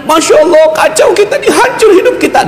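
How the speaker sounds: high, panicky wail of frightened followers; high laughing mock panic. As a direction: squeal it like a crowd in panic, half laughing. Panicking companions quoted.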